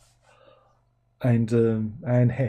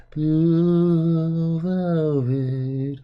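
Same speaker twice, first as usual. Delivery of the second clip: slow appalled echo of a film title; aghast; 3 semitones higher, much slower than usual. Real, not acted. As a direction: stretch the title out slowly, stunned. Stunned repeat of film title.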